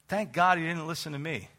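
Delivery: ordinary talk, nothing marked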